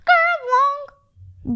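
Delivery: squeaky high, far-off sound effect; bright comic kerplunk. A narrator's reading. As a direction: pop the word out like a cartoon clatter. Sound word in picture book.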